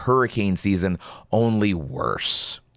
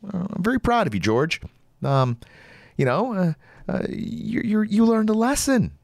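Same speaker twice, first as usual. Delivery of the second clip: sarcastic praise; 10 semitones higher than usual. Real, not acted.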